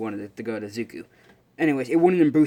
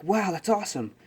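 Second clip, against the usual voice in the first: higher-pitched fanboy wow; acted impressed exclamation; awed delight; 7 semitones higher than usual. Izuku hearing of the hero brother, awed. Retold boy admires classmate.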